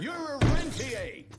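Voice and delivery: loud, high outburst; angry shout. Acted. The director shouts at Jesse for defying him, angry. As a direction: explode at her.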